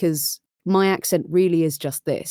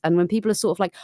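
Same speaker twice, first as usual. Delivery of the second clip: normal talking voice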